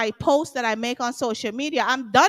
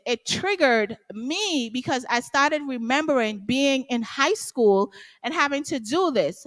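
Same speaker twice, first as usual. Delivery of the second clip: ordinary delivery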